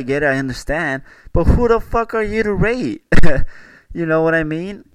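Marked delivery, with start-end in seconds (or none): 1.3-3.0 higher, incredulous rant; who are you to rate, higher and indignant; exasperated outburst. Real, not acted. Ranting at food reviewers. 3.1-3.6 sudden loud laugh; short loud laugh; loud burst of laughter. Real, not acted. Laughs after rant.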